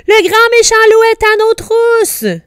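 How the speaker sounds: very high, loud squeal of alarm; sustained high-pitched yell; panicked cry. A narrator's reading. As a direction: shriek it like terrified piglets. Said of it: "crièrent-ils".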